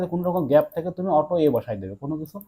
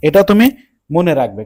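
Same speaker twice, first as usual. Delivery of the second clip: much louder, deliberate teacher's reminder; loud emphasis; 10 dB louder than usual. Real, not acted. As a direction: hammer it home, loudly, in two chunks. Teacher hammering a key rule. (জোর গলায় মনে করিয়ে দেওয়া)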